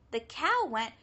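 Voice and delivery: ordinary delivery, nothing marked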